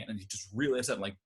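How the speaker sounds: usual speaking voice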